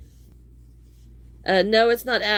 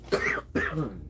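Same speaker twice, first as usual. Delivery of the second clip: cough; 10 semitones lower, 6 dB quieter than usual. Real, not acted. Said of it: "Oh goodness".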